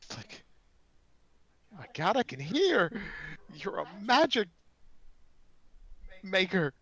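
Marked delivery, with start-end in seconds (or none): 1.7-6.7 loud, high excited halfling; high-pitched, full-voiced exclaiming; amazed yelling. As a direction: shout with joy at hearing for once. Deaf halfling farmer can suddenly hear.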